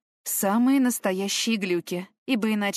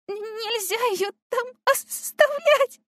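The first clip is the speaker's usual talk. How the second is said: stammered mumble; 3 dB louder, slower than usual. A narrator's reading. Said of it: "пробормотала я".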